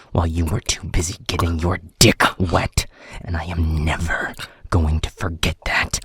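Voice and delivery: loud, deliberate fury building into a threat; grief-fuelled anger. Acted. Alpha mourns best friend and dismisses subordinate, angry and threatening. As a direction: start measured, then drive each phrase hard.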